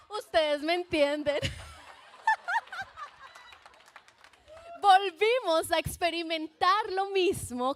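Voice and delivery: loud laughing aside to the audience; laughing knowingly with the audience. Real, not acted. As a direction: share the joke through laughter.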